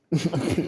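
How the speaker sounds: high, loud burst of laughter; laughing it off. Real, not acted. Laughs at the absurdity.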